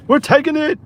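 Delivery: high excited squeal. Real, not acted. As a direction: squeal it out with glee. Accepting the order with gusto.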